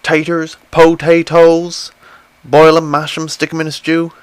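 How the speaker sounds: sing-song hobbit cook; Samwise Gamgee. Acted. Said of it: "Samwise Gamgee".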